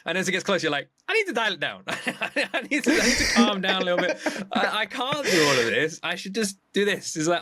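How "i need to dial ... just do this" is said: high, laughing self-talk about overdoing it. Retold or imitated. Talking himself down before the concert, laughing and high. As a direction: giggle through it, teasing your own overexcitement. Planning excess, then reining in.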